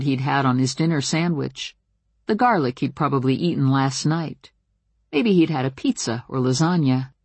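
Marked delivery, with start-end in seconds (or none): none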